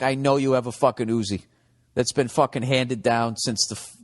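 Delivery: ordinary talk, nothing marked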